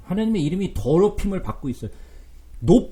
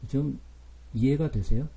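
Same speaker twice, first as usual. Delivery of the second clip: ordinary talk, nothing marked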